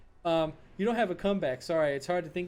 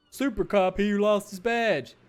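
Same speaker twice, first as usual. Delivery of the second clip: loud slow jeering voice; mocking shout; 4 semitones higher, 8 dB louder, slower than usual. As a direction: jeer at the super cop. Colleagues mocking officer.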